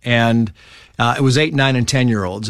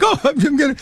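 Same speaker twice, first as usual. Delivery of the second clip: high-pitched blubbering imitation; mock crying; 13 semitones higher than usual. Eight-year-old running punishment laps, mock crying. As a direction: whimper it high, playing a tearful kid. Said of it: "I'm crying like".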